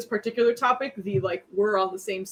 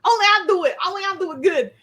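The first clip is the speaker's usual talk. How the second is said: laughing mock voice; 11 semitones higher, 7 dB louder than usual. Mocking possessive phoenix, laughing.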